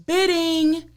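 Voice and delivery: high-pitched mock yell, drawn out; retold yell. The auctioneer telling bidders how to call out, mock yell. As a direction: imitate someone calling out a bid. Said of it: "please hold that paddle high and yell".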